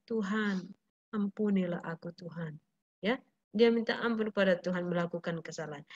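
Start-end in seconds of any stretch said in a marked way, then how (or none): 0.1-2.6 softer, lower plea for mercy; humble prayer. Acted. Peter asking God for forgiveness, soft and humble. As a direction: beg forgiveness gently and low.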